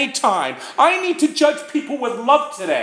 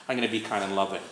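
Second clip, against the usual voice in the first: soft resolve; 11 dB quieter, much faster than usual. Retold or imitated.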